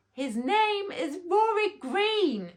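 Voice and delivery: high, loud, slow holler across the stadium. A narrator's reading. Queen bee hollering the name to the whole stadium. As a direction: call the name out to the back row. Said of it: "she hollered".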